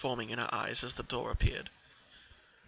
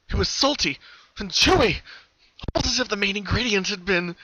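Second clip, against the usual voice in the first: horrified; 7 semitones higher, 5 dB louder than usual. Acted. Disgust as the filly tastes it.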